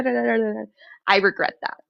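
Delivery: ordinary delivery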